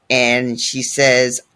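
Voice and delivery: ordinary talk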